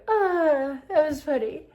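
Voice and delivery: high sigh after laughing; getting it together. Retold or imitated. Daughter recovering from a laughing fit, high and sighing. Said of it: "finally get it together".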